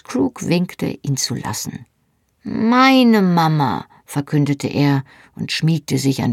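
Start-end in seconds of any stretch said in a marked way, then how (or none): 2.4-3.9 put-on small boy voice. A narrator's reading. Small boy clinging to Jenny.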